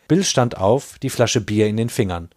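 plain reading voice

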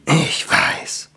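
tender whispered comfort. Acted. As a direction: whisper it to the dying elf. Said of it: "sagte Stramm".

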